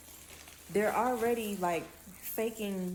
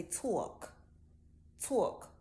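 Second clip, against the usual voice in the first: put-on New York accent. Speaker mimicking northerners for viewers, New York talk. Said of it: "Northern people, like New York".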